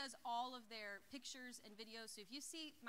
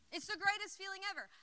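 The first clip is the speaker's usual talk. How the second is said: normal talking voice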